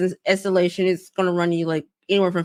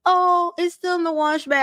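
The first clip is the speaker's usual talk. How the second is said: a clearly raised, breezy staff voice; high employee voice; 12 semitones higher than usual. Retold or imitated. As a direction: chirp it like a carefree clerk.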